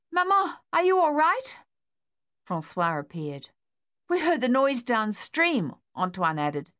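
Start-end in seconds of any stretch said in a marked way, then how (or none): 0.1-1.6 put-on high, loud worried boy calling. 4.1-5.7 put-on boy voice. Boy arriving after the noise.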